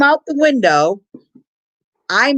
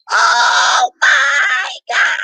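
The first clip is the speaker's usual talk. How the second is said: long, high-pitched wail of fright; hammed-up scream, drawn out and high; retold scream; 12 semitones higher, 4 dB louder, much slower than usual. Retelling her scream as the ride goes backwards, hammed-up wail. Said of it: "all you hear me go".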